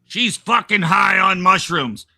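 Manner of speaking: mock-indignant complaint; cranky husband. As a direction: gripe it loudly like a put-out spouse. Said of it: "okay, here's the whole sentence".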